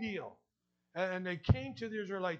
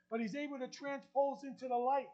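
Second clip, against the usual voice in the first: normal talking voice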